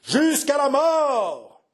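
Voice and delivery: high, slow, drawn-out roar; savage roar. Acted. The cavalry roars back to their leader, savage. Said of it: "d'un accent sauvage, rugirent".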